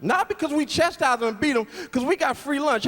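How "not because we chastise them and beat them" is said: ordinary talk, nothing marked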